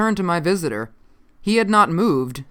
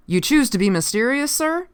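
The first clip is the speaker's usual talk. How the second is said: higher-pitched, impatient question; 9 semitones higher than usual. A narrator's reading. Officer asking the evasive stranger, mildly impatient. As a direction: challenge them, pitch climbing sharply at the end. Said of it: "I asked impatiently".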